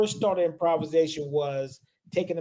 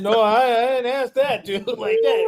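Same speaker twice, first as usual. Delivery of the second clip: flustered protest; 7 semitones higher, 7 dB louder than usual. Retold or imitated. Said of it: "I said".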